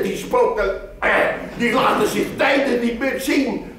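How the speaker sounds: a touch of spooky tale-telling; lightly voiced storyteller. Narrator quoting himself to women. (licht gespeelde verteller)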